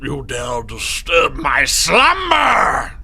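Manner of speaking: slow booming deity shout; sleeping ancient god. Acted. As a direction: roar it slow like an angry god. Awakened god roars at intruder.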